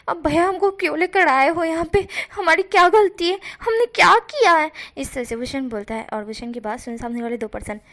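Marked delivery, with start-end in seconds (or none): 0.1-4.7 higher and louder, a scared plea building up; frightened pleading. Acted. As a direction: beg to know what you did wrong. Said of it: "वो कहता है". (डरी हुई ऊँची आवाज़ में गिड़गिड़ाना)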